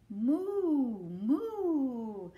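long, loud drawn-out moos; cow mooing. Acted. Reader answers what a cow says, long mooing. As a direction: moo like a storybook cow. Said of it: "said the cow".

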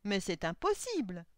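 loud, dismayed objection; astonished protest. A narrator's reading. Old clockmaker answers the suitor, astonished protest. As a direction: protest it in disbelief. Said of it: "dit le vieil horloger effaré".

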